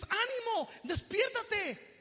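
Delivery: high, loud wake-up call; forceful, drawn-out exhortation; rousing shout. Acted. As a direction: shout them back to courage. Acted rousing call to the discouraged.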